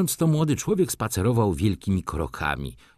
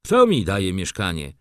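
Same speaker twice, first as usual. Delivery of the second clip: ordinary delivery, nothing marked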